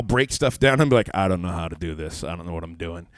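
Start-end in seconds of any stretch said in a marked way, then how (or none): 1.1-3.1 low, deflated admission; mock helplessness. Husband who has broken the house, mock helpless. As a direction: drop the pitch as if out of your depth. Helpless after breaking stuff.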